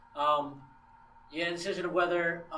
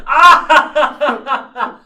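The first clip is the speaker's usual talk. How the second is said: a sudden loud laughing ah; loud king shout; 6 semitones higher, 16 dB louder than usual. Retold or imitated. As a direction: bellow it out. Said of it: "the king goes".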